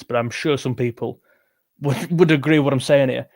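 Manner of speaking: normal talking voice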